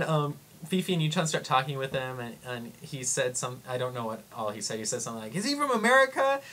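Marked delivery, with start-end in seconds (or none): 5.4-6.4 put-on high-pitched kid's question; imitating an eager kid, high voice; excited little boy. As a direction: pipe up like a curious little boy.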